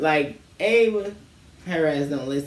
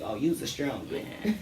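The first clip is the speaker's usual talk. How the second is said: teasing; 3 semitones lower, 9 dB quieter, slower than usual. Retold or imitated. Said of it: "I was like".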